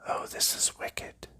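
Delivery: ordinary delivery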